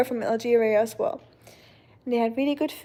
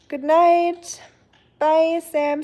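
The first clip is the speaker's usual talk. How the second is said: higher, bright send-off; cheerful goodbye; 5 semitones higher, 3 dB louder, much slower than usual. Real, not acted. Seeing off a viewer named Sam, cheerful goodbye. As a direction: call out a cheery goodnight.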